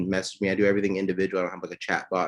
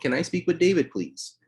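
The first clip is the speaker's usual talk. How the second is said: timid permission ask; 9 semitones higher than usual. Shown as a demonstration. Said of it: "You never wanna say".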